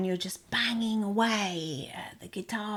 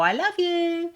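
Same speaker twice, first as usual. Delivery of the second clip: high, lilting "I love you"; tender sing-song; 10 semitones higher, 5 dB louder than usual. Shown as a demonstration. Showing how loved ones hear it, a high lilting tone. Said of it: "yeah, or".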